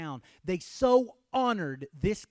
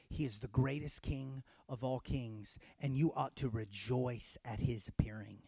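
whisper; 4 semitones lower, 6 dB quieter than usual. Real, not acted. Sudden hush in sermon.

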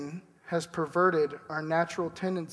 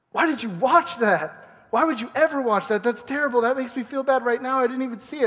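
incredulous friend; 7 semitones higher, 4 dB louder than usual. Retold or imitated. Preacher mocking a friend's gruesome movie pick, high and appalled. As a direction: play the horrified friend who can't believe it. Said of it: "And you're like".

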